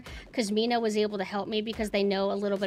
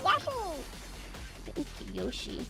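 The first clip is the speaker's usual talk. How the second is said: high squeaky cartoon yahoo; cartoon cheer; 10 semitones higher, 7 dB quieter than usual. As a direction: shout it like a game character.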